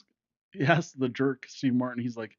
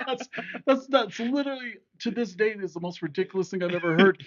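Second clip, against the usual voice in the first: high-pitched, talking through laughter; cracking up at your own joke; laughing through the words; 8 semitones higher, 3 dB louder than usual. Real, not acted. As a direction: fight to speak through helpless laughter, settling as it goes.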